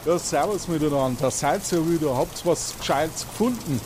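hearty, folksy hello to the returning investigators; Toni greeting. Acted. Game master as caretaker Toni greets the investigators, lightly Bavarian and hearty.

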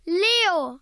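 loud, high yell of a name; angry shout. A narrator's reading. Isabel calls out for her little brother, angry shout. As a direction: shout angrily for your brother. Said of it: "Isabel wurde sauer und schrie".

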